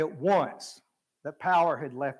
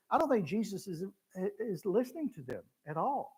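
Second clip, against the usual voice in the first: ordinary talk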